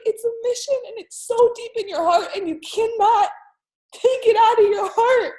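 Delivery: crying. Retold or imitated. Uncle telling niece it's a mission, crying. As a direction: press it home through tears. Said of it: "crying with him".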